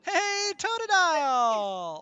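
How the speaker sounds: loud, drawn-out call to a new subscriber; excited greeting. Real, not acted. Greeting a new subscriber on stream, loud and excited. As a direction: sing out the name with delight.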